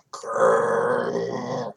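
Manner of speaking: belly growl. A narrator's reading. Bear belly rumble.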